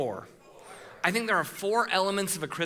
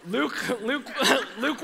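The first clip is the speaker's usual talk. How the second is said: laughing it off; 6 semitones higher, slower than usual. Real, not acted. Laughing at own mistake.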